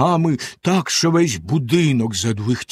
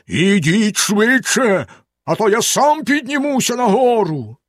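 terrible voice; 8 semitones higher than usual. A narrator's reading. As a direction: bellow down the threat, dragging it. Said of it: "заволав страшним голосом". (страшним голосом, погрозливо)

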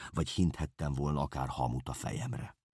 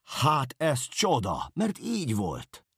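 high, loud, slow joyful cry; rejoicing shout; joyful exclamation; 11 semitones higher, 6 dB louder, slower than usual. Acted. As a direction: cry out in delight. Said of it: "örvendezve kiáltottam fel".

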